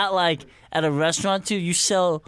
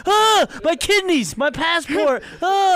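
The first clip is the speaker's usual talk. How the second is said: high, loud panicked cry; panicked yell; 12 semitones higher, 5 dB louder, slower than usual. Retold or imitated. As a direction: wake up terrified and yell. Waking in panic, retold.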